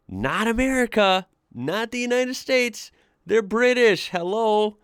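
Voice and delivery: high-pitched, incredulous correction; squeaky, exasperated "how can you not know". Real, not acted. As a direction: spell out the obvious in disbelief. Mock exasperation at the ignorant Texan.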